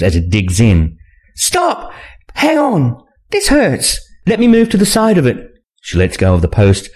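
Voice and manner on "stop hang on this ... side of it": much higher-pitched woman's voice; pained female character. A narrator's reading. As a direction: protest in pain, pitched far up. Woman in pain protesting.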